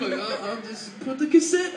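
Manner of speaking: lightly voiced dozy father; sleepy dad. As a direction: mumble a bit like a drowsy dad. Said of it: "he's just asleep".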